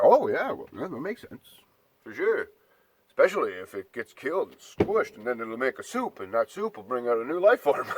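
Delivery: sarcastic, sing-song fake agreement, mostly in his own voice; mock gullible agreement. Ranting to viewers, a mild mock of a believer nodding along. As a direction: lightly mimic a believer who swallows anything.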